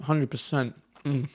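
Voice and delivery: talking through a mouthful of food; chewing while talking. Real, not acted. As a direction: speak while still munching. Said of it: "I'm munching mid-pod".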